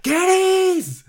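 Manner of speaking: high, loud mock yell. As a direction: bellow the name like an outraged movie character. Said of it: "'cause he's yelling".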